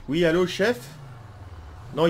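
ordinary talk, nothing marked

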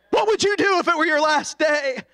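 normal talking voice

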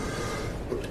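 dry harsh coughs; real coughing fit mid-testimony. Real, not acted. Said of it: "broke into a coughing fit".

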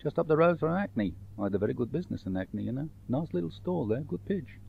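chatty North London trader; North London accent. Acted. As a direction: confirm it as a London shopkeeper. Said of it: "he lived for years in North London".

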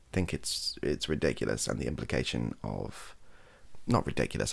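ordinary talk

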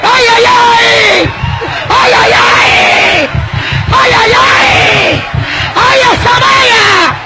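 high, drawn-out revival cries; full-voice Pentecostal outburst; ecstatic shouting. Real, not acted. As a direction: cry out as if seized by the Spirit. Ecstatic cries, apparent tongues.

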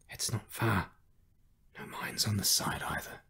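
soft, half-whispered reassurance; hushed old man. A narrator's reading. Old man guiding his rescuers in the desert, hushed.

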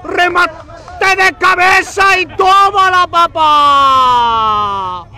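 screaming, stretched goal call; breakneck goal commentary. Real, not acted. Goal call, huge shout.

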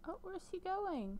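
high, slow, puzzled oh; suddenly high and drawn-out surprise; surprised. Real, not acted. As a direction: caught off guard as he wanders off. Sim walks off mid-date.

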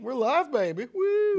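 high, loud whoop of joy; excited cheer. Real, not acted. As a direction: cheer it like the crowd's yours. Celebrating going live on air.

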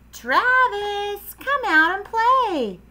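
high, loud singsong holler. Acted. Froggy at Travis's house, hollering for him. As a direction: holler out to a friend, holding the words. Calling friend outside, as before.

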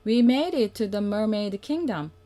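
ordinary delivery

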